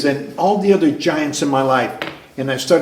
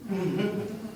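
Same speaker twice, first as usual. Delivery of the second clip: laugh; 6 semitones higher, 13 dB quieter than usual. Real, not acted. Punchline of Mike's story.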